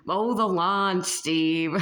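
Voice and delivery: put-on playful North Boston accent nagging Steve. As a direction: bark the chore in a mild North Boston accent. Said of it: "North Boston accent saying".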